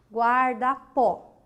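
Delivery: "Guarda-pó" slowly, guar, da, pó; syllable by syllable. Shown as a demonstration. Teacher showing the last syllable is strong, "Guarda-pó" slowly split. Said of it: "A última sílaba é a sílaba forte".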